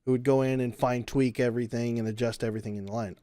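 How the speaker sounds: ordinary talk, nothing marked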